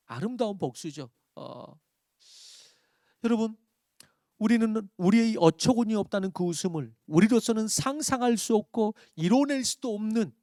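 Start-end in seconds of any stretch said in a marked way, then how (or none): 3.2-10.3 louder, higher exhortation; rally the congregation; fervent sermon appeal; preaching at full force. Acted.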